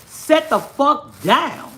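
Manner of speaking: very slow, high, firm order; drawn-out angry command; stern scold. Pretend. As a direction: bark it word by word. Scolding the child.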